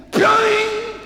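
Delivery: high cartoon boing; spring-like boing of the arrow; boing sound effect. Arrow lands on the wardrobe, a high cartoon boing.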